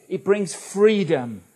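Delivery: normal talking voice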